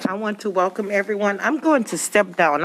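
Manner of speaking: usual speaking voice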